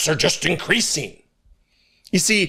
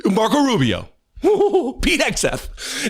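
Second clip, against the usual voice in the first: laughing while listing one more name; 6 semitones higher than usual. Real, not acted. Naming names, laughing.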